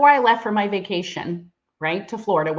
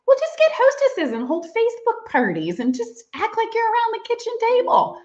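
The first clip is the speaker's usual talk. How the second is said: husband's reply put on in a much higher voice; a husband's easy fix, voiced high and light; mock husband voice; 3 dB louder than usual. Husband's advice retold.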